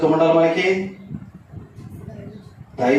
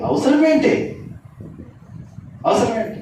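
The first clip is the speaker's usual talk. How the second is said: rising preaching emphasis; 9 semitones higher than usual. Real, not acted. Preacher challenging congregation.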